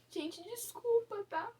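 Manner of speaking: apologising to viewers while crying; tearful apology. Real, not acted. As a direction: apologise through tears. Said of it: "Eu não queria chorar nesse vídeo".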